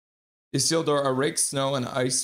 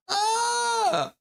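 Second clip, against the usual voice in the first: shrieking laugh. Real, not acted. Streamer spots his own thumbnail blunder and shrieks with laughter. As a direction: let out a shrill shrieking laugh.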